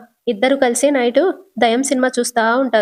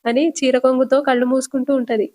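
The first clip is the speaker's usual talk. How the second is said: usual speaking voice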